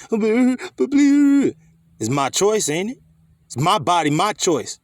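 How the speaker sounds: high, drawn-out sneering parody of the slogan; mock pro-choice woman. Preacher mocks women chanting the slogan, slow high sneer. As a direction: stretch it out slowly like a whiny caricature.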